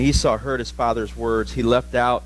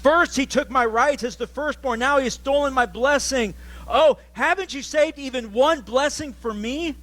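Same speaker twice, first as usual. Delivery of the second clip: exclaimed; 10 semitones higher than usual. Acted. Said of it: "Esau exclaimed".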